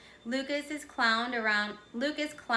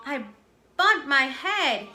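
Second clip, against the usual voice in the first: slow, pained moan, a little louder; 4 semitones higher, 6 dB louder than usual. A narrator's reading. Lucas to teacher after falling, slow mock moan. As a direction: groan it out after a fall. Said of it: "he moaned as he stood up".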